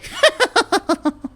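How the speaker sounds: very high, excited goblin cackle. Acted. Goblins cackling with excitement, high. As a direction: cackle with glee.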